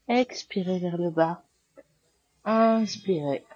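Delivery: firm, clear cues over the breath; yoga class cueing. Acted. Yoga teacher guiding students through sun salutations, firm cues. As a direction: call out each pose and breath to the class.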